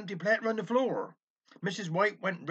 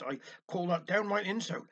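ordinary delivery

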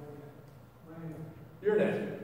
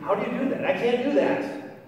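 intrigued; 8 semitones higher, 7 dB louder than usual. Retold or imitated. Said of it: "I was intrigued".

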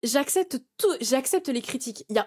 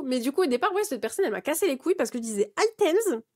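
ordinary talk

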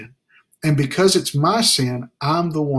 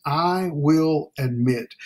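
"I will admit" slow with weight on "I"; slow, stressed; much slower than usual. Shown as a demonstration. Preacher naming the third action, slow and deliberate. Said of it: "the third point of action".

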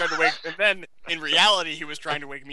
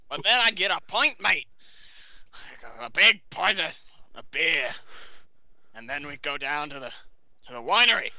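mock Australian with swooping pitch; Australian accent. Shown as a demonstration. Telling of a pint and the winery, in an Australian accent. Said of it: "in Australian accent to you".

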